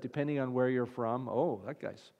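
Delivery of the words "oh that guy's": mock impressed. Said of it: "certain dialects and sounds that".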